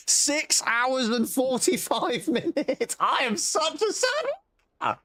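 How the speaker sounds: very high, drawn-out wail; high-pitched, laughing lament; self-mocking despair. Real, not acted. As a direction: wail slowly at your own silliness. Mocking own wasted hours.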